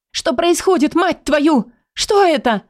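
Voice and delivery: high, cracking near-scream; almost shouting. Acted. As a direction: voice breaking as she nearly yells. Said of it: "Едва ли не кричу, срываясь".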